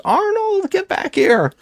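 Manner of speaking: very high hammed-up cry after the head; mock frantic yell. Woman chasing the car with her boyfriend's head, hammed-up cry. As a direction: shriek after the flung head like a B-movie woman.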